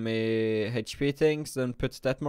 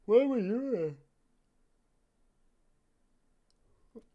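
sudden high startled question; surprised; 11 semitones higher than usual. Real, not acted. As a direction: caught off guard, voice jumps up. Startled by something on screen.

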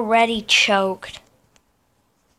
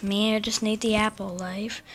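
ordinary talk, nothing marked

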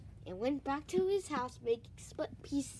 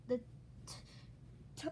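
ordinary delivery, nothing marked

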